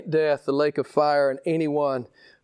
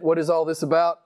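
usual speaking voice

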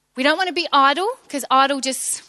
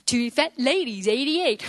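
bingo caller; slower than usual. Retold or imitated. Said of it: "bingo, been there".

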